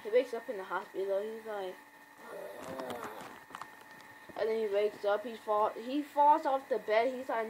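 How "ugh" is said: low, soft imitated groan; waking groan. Comic fan voicing Rick waking in hospital, low groan. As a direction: groan faintly like coming to.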